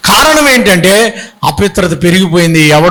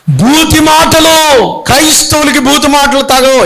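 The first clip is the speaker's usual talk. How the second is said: emphatic preaching; 6 semitones higher than usual. Real, not acted. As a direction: drag each word out in condemnation. Preacher condemning foul words.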